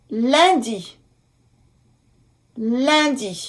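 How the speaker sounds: "Lundi" in French, nasal "lun-", then "di"; careful French pronunciation. Shown as a demonstration. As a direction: model "Lundi" with a nasal first syllable. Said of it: "U N".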